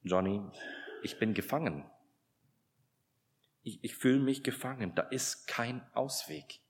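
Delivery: mildly voiced husband confessing despair; husband admits to his wife he feels trapped, softly tearful; retold weeping. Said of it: "sagte zu Johnny weinend".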